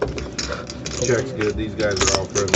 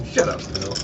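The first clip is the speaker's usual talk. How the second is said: unvoiced mock dismissal; imitating a friend; 5 semitones lower, 4 dB quieter than usual. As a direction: mimic the snap under the breath.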